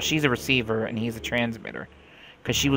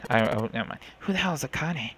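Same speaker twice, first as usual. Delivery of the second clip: ordinary delivery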